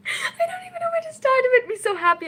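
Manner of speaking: high, cracking squeal of emotion; overwhelmed fangirl squeal, almost crying; emotional squeal. Real, not acted. Streamer overwhelmed by her favourite show, squealing.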